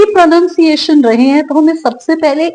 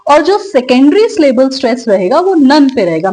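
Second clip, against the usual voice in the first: ordinary talk, nothing marked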